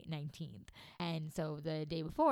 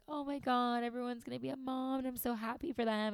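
high, euphoric gushing; 8 semitones higher than usual. Retold or imitated. Mom euphoric for all the expecting mothers, high euphoric gush. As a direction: gush it out, pitched high with happiness. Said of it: "like, euphoric".

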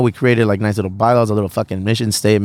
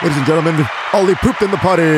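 high, booming mock announcement; announcer voice; 6 semitones higher than usual. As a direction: announce it to a stadium crowd. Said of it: "Play the applause".

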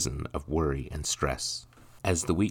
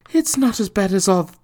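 much higher, breaks off mid-word; mother cut short; 10 dB louder than usual. Acted. Mother reassuring her son, then freezing. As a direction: stop dead at the sight. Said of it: "her tongue froze in her mouth".

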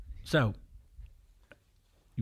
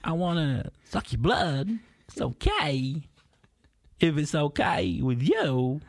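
slow, spaced Walken-style vampire, a touch higher; Christopher Walken impression; 4 semitones higher, slower than usual. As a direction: drawl it out like Walken playing a vampire. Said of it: "this Christopher Walken impression".

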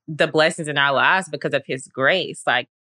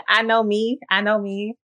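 usual speaking voice

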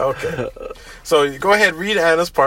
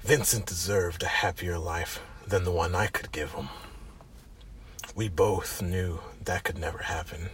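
wannabe Snake Plissken; 9 semitones lower, 14 dB quieter than usual. Acted. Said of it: "Sounds like some wannabe Snake Plissken".

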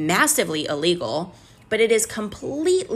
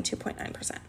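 whispered under her breath; muttered figure; 14 dB quieter, faster than usual. Real, not acted. Repeating the fee to herself, whispered. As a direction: breathe the number out to yourself.

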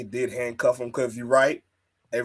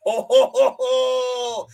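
Finn Balor cackle; 9 semitones higher, much slower than usual. Retold or imitated. Mocking the wrestler's cocky laugh again for his cohost, hammed up. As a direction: ham up a smug villain laugh. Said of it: "Finn Balor try to be cocky with his voice".

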